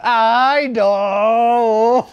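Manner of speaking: dismayed cry. Real, not acted. Reacting to the crying girl story.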